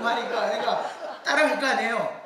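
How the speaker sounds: ordinary delivery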